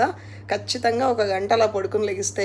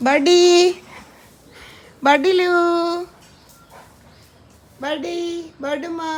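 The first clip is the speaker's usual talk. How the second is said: calling the dog; 9 semitones higher, 6 dB louder than usual. Real, not acted.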